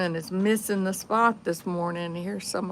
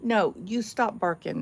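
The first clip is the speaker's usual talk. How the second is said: ordinary talk, nothing marked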